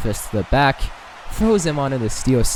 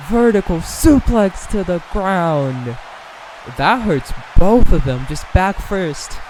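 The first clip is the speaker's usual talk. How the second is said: excited call; 5 semitones higher, slower than usual. Real, not acted. Big move in play-by-play.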